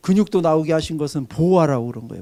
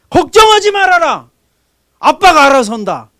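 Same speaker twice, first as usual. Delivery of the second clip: 걱정하지 shouted much higher and louder, firm fall; bold fatherly assurance; 9 semitones higher, 11 dB louder than usual. Retold or imitated. Father boldly telling his children not to worry, loud and firm. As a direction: proclaim it like a father taking charge. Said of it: "그건 아버지 아닙니다".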